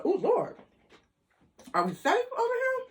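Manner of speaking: high alarmed are-we-safe; mock worry. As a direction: fret in a high nervous voice. Worried in a rough area.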